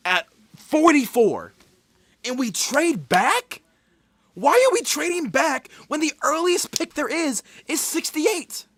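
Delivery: furious fan shouting in disbelief; straight up mad. Real, not acted. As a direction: yell each phrase in exasperation. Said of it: "I'm straight up mad".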